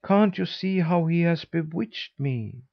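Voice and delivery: put-on high, beseeching boy's voice; pleading boy. A narrator's reading. Bewitched boy appealing to the cat, high plea. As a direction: appeal to the cat, pitched well up. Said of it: "said the boy".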